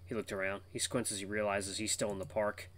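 ordinary delivery

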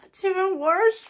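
high strained squeal; shocked squeal. Real, not acted. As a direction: squeal it out in pain. Reacting to sour candy.

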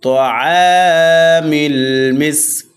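slow chanted তাআমিল, long held "আ"; three-alif madd. Shown as a demonstration. Teacher reciting the verse, long stretches in তাআমিল মিসকিন. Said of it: "তিন আলিফ টানিয়া পড়িতে হয়".

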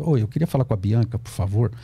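tame it into a courteous, apologetic ask; polite request. Retold or imitated. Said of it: "esse aqui é o cara domado pela sociedade".

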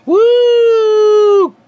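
high loud woo; shrill celebratory cheer. Acted. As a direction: whoop for joy.

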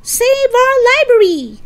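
high, full-volume protest shout. Acted. Lisa defies the man in the van, shouting. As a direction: belt out the slogan in defiance. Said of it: "Lisa shouted".

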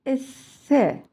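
ESSE with a lisped th-like s. Shown as a demonstration. Teacher doing all three together for children, lisped s. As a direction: say "Esse" with the tongue near the front teeth.

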